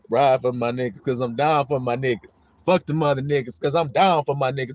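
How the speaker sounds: low, committed slogan delivery; loyal rider. Acted. Preacher voicing a teammate's pledge, low loyal declaration. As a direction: chant it low like a loyal crew member.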